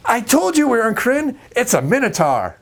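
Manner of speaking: very high, triumphant; the lore nerd proven right; smug player. Retold or imitated. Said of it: "that first player's gonna go".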